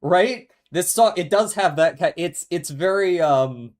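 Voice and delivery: excited. Real, not acted. Excited about the song.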